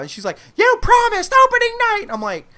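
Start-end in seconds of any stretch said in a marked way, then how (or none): 0.6-2.0 very high, loud mimicked girlfriend; hammed-up high-pitched yell; outraged protest. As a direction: screech the broken promise as an accusation. Girlfriend protests broken promise.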